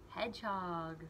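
ordinary delivery

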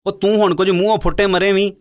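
mildly exasperated nudge; impatient buyer pressing the seller, a little irked; slightly irritated. A narrator's reading. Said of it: "ਥੋੜ੍ਹਾ ਜਿਹਾ ਖਿਝ ਕੇ ਕਿਹਾ".

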